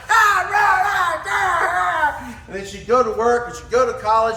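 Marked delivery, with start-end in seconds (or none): 0.1-2.2 high, loud hammed-up growling; imitating a tantrum; mock rage noises. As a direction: rage like you're throwing things. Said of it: "Throwing stuff around".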